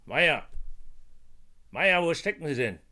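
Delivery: loud impatient call across rooms. Acted. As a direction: shout for the clerk next door. Boss calls for his clerk.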